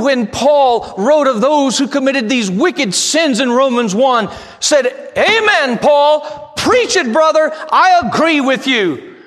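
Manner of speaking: loud, driving pulpit preaching; preaching at full force. Acted. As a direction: hammer it home to the congregation.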